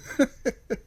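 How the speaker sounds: a high little laugh; light laugh, not taking it seriously; amused chuckle. Acted. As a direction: chuckle it away. Mocking waitress's superstition.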